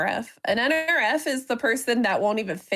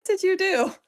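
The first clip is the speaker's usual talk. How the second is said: high suspicious question; mock suspicion; 8 semitones higher than usual. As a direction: answer kindness with pitched-up suspicion. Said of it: "like, sympathetic, I would be like".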